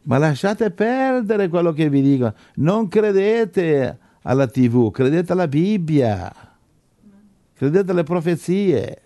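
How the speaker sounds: high-pitched, insistent exhortation; impassioned preaching. Real, not acted. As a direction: drive each command home like a preacher. Preacher exhorts listeners to believe. (predicazione infervorata, esortazione accorata)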